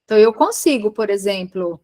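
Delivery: ordinary talk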